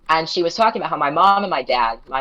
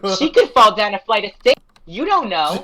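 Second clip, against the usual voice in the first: high, loud, conspiratorial drunk imitation; tipsy roommate; 8 semitones higher, 6 dB louder than usual. Drunk roommate plots against crush's girlfriend, high and emphatic. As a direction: push the voice high and loud, scheming merrily. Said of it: "hilarious drunk".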